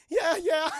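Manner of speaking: loud, high mock-eager agreement; flustered, laughing. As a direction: play awkward eagerness while cracking up. Flustered Goliath, speaker cracking up.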